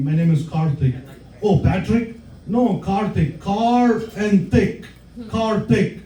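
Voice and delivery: usual speaking voice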